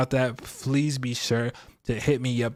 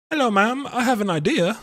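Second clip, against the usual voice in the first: high, lightly put-on posh British accent; a mock upper-class British accent, pitched up; 10 semitones higher, 4 dB louder than usual. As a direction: play at being a posh Brit greeting a mogul. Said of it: "Why are you speaking in that accent?".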